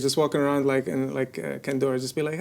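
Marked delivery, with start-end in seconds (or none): none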